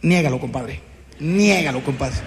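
low, conspiratorial friend voice; mock buddy advice. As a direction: play the sly compadre tipping off a friend. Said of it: "En México tenemos una filosofía".

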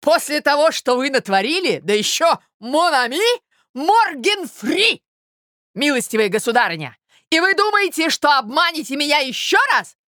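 high, loud, outraged tirade; frenzied indignation. A narrator's reading. Suitor accuses Marya of deceit, enraged. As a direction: hurl mocking outrage at her. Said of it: "вскричал он в бешенстве".

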